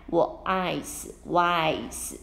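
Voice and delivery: "wise" with a slow, long I vowel; teacher modelling the long I; long i demo. Shown as a demonstration. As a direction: stretch the I in "wise". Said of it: "发长音的爱".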